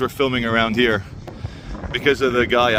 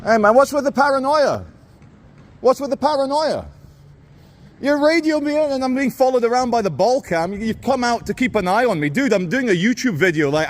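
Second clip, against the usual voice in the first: pitched well up, incredulous and indignant; exasperated confronting; 7 semitones higher than usual. Real, not acted. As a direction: call the guard out, fed up and disbelieving. Confronting the security guard.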